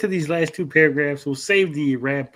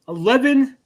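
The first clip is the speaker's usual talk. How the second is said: eleven shot up high and loud; outraged eleven; 10 semitones higher, 5 dB louder than usual. Real, not acted. Counting paragraphs for the audience, outraged eleven. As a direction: land hard on eleven like a punchline.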